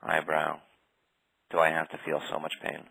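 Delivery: usual speaking voice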